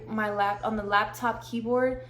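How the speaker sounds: ordinary delivery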